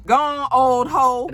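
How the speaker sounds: loud, slow, drawn-out send-off; mock dismissive. Vlogger imitating castmate dismissing a rival, loud mock taunt. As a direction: stretch it out loudly as a parting jab. Acts dismissive parting shot.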